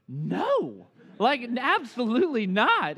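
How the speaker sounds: high, loud mock refusal; retold indignant refusal. Betrayed son to dad offering a hug, mock indignant refusal. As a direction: imitate a betrayed kid refusing outright.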